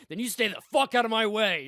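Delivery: retold angry warning, half acted; mock angry threat. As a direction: threaten him in a hammed-up way. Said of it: "he got real angry about it".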